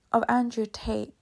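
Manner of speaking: usual speaking voice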